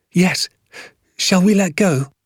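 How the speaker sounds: put-on high, impatient child's voice; boy bursting to go; eager boy. A narrator's reading. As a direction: ask dad eagerly, pitched right up. Excited boy awaits the launch.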